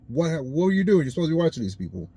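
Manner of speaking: a loud, high reproach that speeds up at the end; Moses scolding. Retold or imitated. Moses scolds his brother Aaron over the idol, loud and high. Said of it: "he looks at his brother Aaron and is like".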